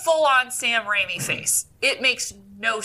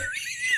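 high laugh burst; 6 dB quieter than usual. Real, not acted. Laughs at own confusion.

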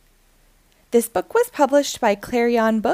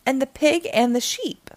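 usual speaking voice

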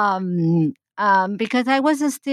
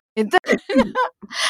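high laugh; 11 semitones higher than usual. Real, not acted. Laughing at her punchline.

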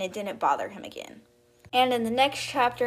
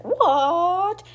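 surprised; 9 semitones higher, 6 dB louder than usual. Retold or imitated. Daisy reacts to everyone gasping.

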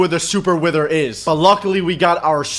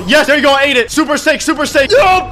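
high, loud gamer hype; excited cheering; 7 semitones higher, 5 dB louder than usual. Real, not acted. Gamer powering up mid-fight, excited cheering. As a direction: fire it out high and loud, thrilled. Frantic eating during boss fight.

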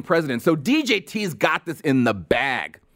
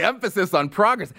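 higher, quicker disbelieving repeat; scornfully questioning it; incredulous scoff; 4 semitones higher than usual. Real, not acted. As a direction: throw the slogan back in disbelief. Scoffs at the slogan just mimicked.

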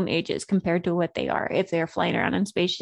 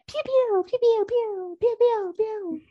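high-pitched laser blasts; laser pew pew; 12 semitones higher, slower than usual. Pretend. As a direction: zap it out like a toy ray gun. Laser gun sound effect.

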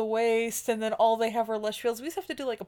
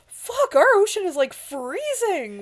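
louder, higher griping that drags on freezing; mock complaint; 5 semitones higher, 4 dB louder, slower than usual. Alien complaining about their cold ocean, mock whine.

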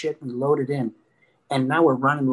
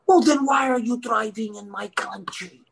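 higher-pitched mock scolding; indignant cop; 6 semitones higher than usual. Cop scolding a foreign driver, mock indignant. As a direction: lightly act an outraged policeman. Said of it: "He goes".

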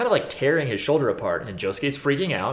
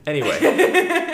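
laughing; 5 dB louder, much slower than usual. Real, not acted. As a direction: laugh through the word. Laughing after joke.

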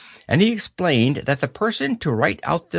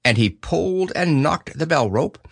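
usual speaking voice

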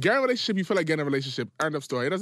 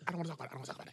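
mock mumbled brush-off; 14 dB quieter, much faster than usual. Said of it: "You like".

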